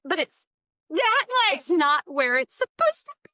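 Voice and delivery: high, urgent pleading, rising to loud; retold panic, insist on being believed; frantic explaining. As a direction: build to a high, loud mock-frantic protest. Retold frantic plea continues after interjection.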